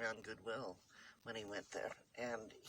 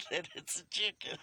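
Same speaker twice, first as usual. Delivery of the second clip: laughing; 5 semitones higher than usual. Real, not acted. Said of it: "remind it".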